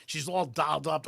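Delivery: ordinary delivery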